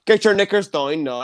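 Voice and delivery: mock Northern Irish seducer; Northern Irish accent. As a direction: imitate a hard Northern Irish accent for a laugh. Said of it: "with the Northern Irish accent".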